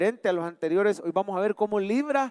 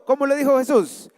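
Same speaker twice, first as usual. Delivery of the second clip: ordinary talk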